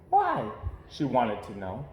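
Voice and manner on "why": high, bright little cub voice, greeting everyone; cheery little girl. Acted. Little Sister greeting every passerby, cheery high girl voice. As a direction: sing out hello to every passerby.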